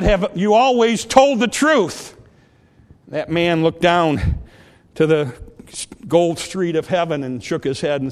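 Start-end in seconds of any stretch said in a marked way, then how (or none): 0.0-2.2 loud, slow, lofty voice asking from on high; questioning voice from the throne; voice from the light. Retold or imitated. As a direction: boom it down like a voice from heaven. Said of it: "A voice came out of that light and asked".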